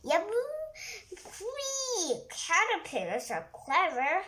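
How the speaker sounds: loud, bright exclamation of surprise; amazed animals. Acted. Animals marvelling at the caterpillar, loud and high. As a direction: gasp at how clever the caterpillar is.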